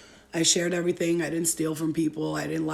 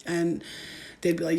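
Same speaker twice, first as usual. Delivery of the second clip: normal talking voice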